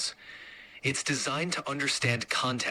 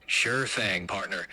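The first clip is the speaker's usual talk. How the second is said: lower, folksy Eastern Kentucky drawl; Eastern Kentucky accent; 5 semitones lower, slower than usual. Pretend. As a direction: talk like a friendly Eastern Kentucky local. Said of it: "in a thick Eastern Kentucky accent".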